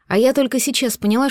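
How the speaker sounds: ordinary delivery, nothing marked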